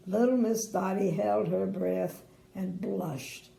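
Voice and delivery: ordinary delivery, nothing marked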